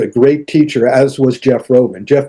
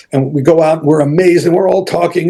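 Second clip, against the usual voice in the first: ordinary talk, nothing marked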